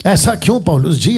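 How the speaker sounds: ordinary talk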